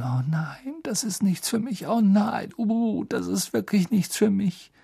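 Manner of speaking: high, scared muttering; clinging on, terrified of flying; frightened mumbling. A narrator's reading. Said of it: "Er konnte sie murmeln hören".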